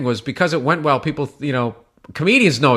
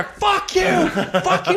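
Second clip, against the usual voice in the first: very high, strained mock yell; imitated escalating yell; much slower than usual. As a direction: push the pitch way up, not the volume. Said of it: "he would yell louder".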